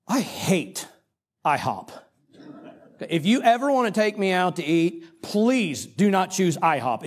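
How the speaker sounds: blunt loathing. Retold or imitated. Preacher confessing to the church what he thought of IHOP, blunt loathing. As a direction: plead with heavy emphasis, leaning on each word. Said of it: "I'm just gonna say it like I thought it".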